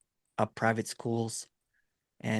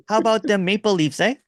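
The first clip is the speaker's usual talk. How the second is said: higher, louder hammed-up Canadian accent; mock Canadian accent; 9 semitones higher, 7 dB louder than usual. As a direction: play a jolly over-the-top Canadian accent while drinking. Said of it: "trying to talk like Canadian".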